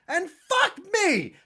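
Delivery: high, slow sarcastic amazement; mock astonishment. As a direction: fake awe, drawn out.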